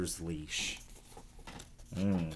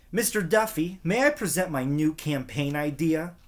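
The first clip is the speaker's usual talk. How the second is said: higher, bright classroom pupil voice; eager schoolgirl; 6 semitones higher, 5 dB louder than usual. Acted. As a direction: ask like a keen student. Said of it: "she asked".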